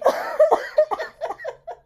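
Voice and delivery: high, loud cackling laugh; loud laugh burst. Real, not acted. Laughing fit.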